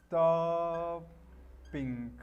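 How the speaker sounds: "stopping" with a long held sto, pause, then ping; drawn out in syllables. Shown as a demonstration. Teacher shows the gerund of stop, drawn out. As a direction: split "stopping" slowly into two level syllables. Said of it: "stop quedaría".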